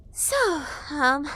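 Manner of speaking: high hesitant fairy voice; nervous, halting fairy; stammering fairy. A narrator's reading. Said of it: "she stammered".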